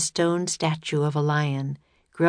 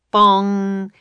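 mock bell bong; 3 semitones higher, 6 dB louder than usual. A narrator's reading. Clock striking.

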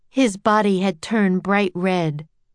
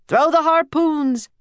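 higher attacking order; yelling; 8 semitones higher, 4 dB louder than usual. A narrator's reading. Sailor calling for an attack, yelling. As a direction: shout the command across deck. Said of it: "yelled a sailor".

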